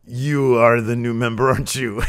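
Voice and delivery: a lightly voiced Alberto scolding; mock-scold his brother, a touch pointed; hint of a scolding older brother; berating brother. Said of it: "he just starts ripping into his brother".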